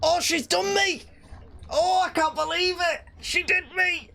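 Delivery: high-pitched wail of disbelief; real high-pitched outrage; anguished disbelief. Real, not acted. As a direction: cry out high, as if cheated. Outraged at being beaten in game.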